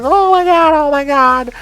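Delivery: very high, loud horrified cries; acted panic. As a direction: mimic panicked bystanders, high-pitched. Bystanders react to a gruesome injury.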